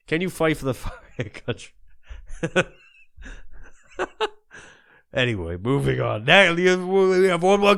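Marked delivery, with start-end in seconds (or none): 0.1-2.7 breaking into laughter mid-question; can't keep a straight face on the repeat; laughing. Acted. Host repeats his question to soldiers, cracking up.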